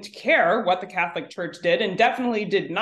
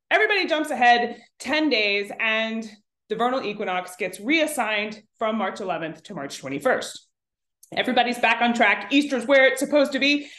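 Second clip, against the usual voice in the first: normal talking voice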